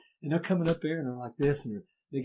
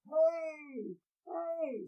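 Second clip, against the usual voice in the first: high, drawn-out call up the hill; a faint far-off "hey" calling up a gully; distant call. Retold or imitated. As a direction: hail someone from far below.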